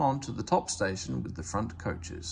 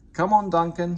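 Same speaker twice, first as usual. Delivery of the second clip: higher, loud urging; urging call; 7 semitones higher, 8 dB louder than usual. A narrator's reading. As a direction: call him to keep going. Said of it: "said his driver".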